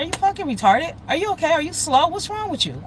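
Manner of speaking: high-pitched, exasperated retold questions; retold angry scolding, high; mad. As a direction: fire off incredulous questions, pitched well up. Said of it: "I got mad".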